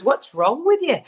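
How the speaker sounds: a British husband asking what's wrong. Retold or imitated. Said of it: "he's from the United Kingdom. So he goes".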